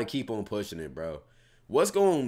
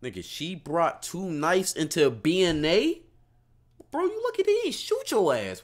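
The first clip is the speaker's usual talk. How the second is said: incredulous; 7 semitones higher than usual. Real, not acted. Streamer reacting to knives taken into an airport, incredulous. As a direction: react in pitched-up disbelief, drawing out the shock.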